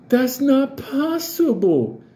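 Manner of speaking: high-pitched, drawn-out agent impression; high, slow mocking imitation; Federal Agent Garcia. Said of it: "That was her fucking voice".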